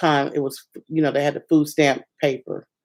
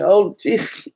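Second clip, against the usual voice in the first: high, dismayed groan of oh jeez; 4 semitones higher than usual. Retold or imitated. Alone in a new city, finding her car broken into: dismayed oh jeez. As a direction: sigh it out in dismay. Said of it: "I'm like".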